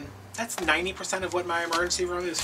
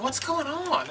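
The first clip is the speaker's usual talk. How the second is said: cheery doctor; 12 semitones higher, much slower than usual. Retold or imitated. Doctor voiced in a retold story.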